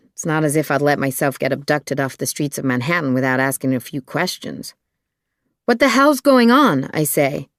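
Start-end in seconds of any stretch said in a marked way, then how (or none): 5.7-6.9 high, raised-voice challenge; alarmed demand. Acted. Woman confronting two men abducting her, high indignant demand. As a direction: demand answers, pitched high and loud.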